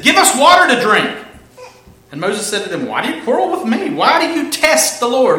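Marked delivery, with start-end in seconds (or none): 0.0-1.1 loud, high quarrelsome demand; acted outcry at their leader; angry demand. Acting the quarreling Israelites' demand. 2.9-5.4 high, urgent acted protest; raised-voice remonstration; exasperated challenge. Acting Moses answering the quarrel.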